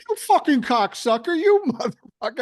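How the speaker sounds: high, loud mock road rage; wife cursing at a driver, mock furious; road rage swearing. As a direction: yell it at the driver who cut in. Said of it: "She goes".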